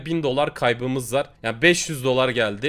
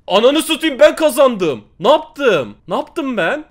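high, loud cry of disbelief at winning; real stunned winner yelling; ecstatic shout; 9 semitones higher, 9 dB louder than usual. Real, not acted. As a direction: scream the win out. Shocked to have won the battle.

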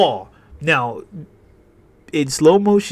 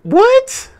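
startled what; 6 dB louder, slower than usual. Real, not acted. Sudden shock at game event.

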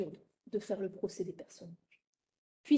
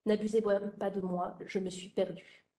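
usual speaking voice

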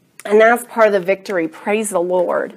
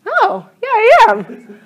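high-pitched little reply; mock-agreeable, pitched way up; acted sweet agreement; 12 semitones higher than usual. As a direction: put on a high voice to play along. Acting own reply to husband's compliment.

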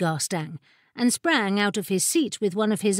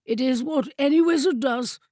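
high-pitched, haughty wizard voice; lofty rival wizard; 4 semitones higher than usual. A narrator's reading. Rival wizard explaining to the court ladies, loftily. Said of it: "said the rival wizard loftily".

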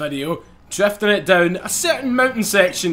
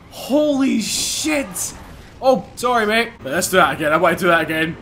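ordinary delivery

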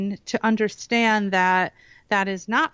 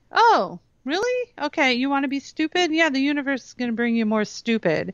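high mock-surprised "oh, really?", then quick retort; mock challenge; 5 semitones higher than usual. Mom answers daughter's self-putdown, mock challenge. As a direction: call their bluff with playful sarcasm, higher and brisk. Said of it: "And I'm like".

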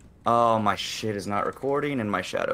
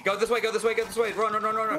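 being chased; 10 semitones higher than usual. Real, not acted. Fleeing another team mid-fight.

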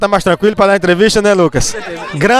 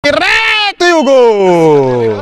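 slow stretched goal call; explosive goal commentary; 3 semitones higher, much slower than usual. Real, not acted. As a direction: draw out the gol.

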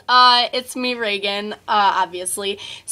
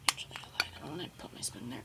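fast whisper; 6 semitones lower, 17 dB quieter, much faster than usual. Real, not acted. Muttered aside while preparing.

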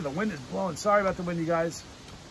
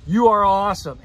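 loud shout-out; 4 semitones higher, 11 dB louder than usual. Real, not acted. Shout-out to Amy.

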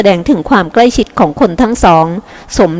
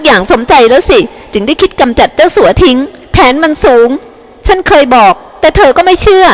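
high, furious accusing woman, climbing higher; hysterical rant; 6 semitones higher than usual. A narrator's reading. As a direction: rant wildly, building to a higher peak. Hysterical widow accusing the son. (โวยวายเสียงสูงขึ้นเรื่อยๆอย่างคลุ้มคลั่ง)

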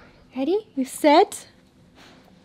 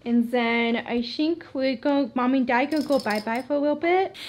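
ordinary talk, nothing marked

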